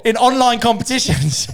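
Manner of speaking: loud, high jeer after a laugh; incredulous mockery. Real, not acted. Mocking workmate who plays darts online, incredulous shout.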